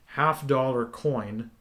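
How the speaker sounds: ordinary talk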